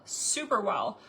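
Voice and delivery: usual speaking voice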